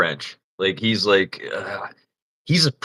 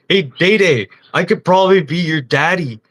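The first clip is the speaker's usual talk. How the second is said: high, raised mock offer; playful raised call; teasing call; 7 semitones higher, 5 dB louder than usual. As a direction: tease him loudly. Teasing coworker up ladder.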